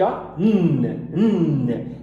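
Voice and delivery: sustained N sound on its own; drawn-out final N. Shown as a demonstration. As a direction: hold the N as a long hum. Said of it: "Todo N no final de palavra você tem que".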